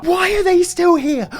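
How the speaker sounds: high, loud, drawn-out exasperated question; exasperated outburst. Acted. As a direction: cry it out in mock despair. Ranting, repeats question, escalating.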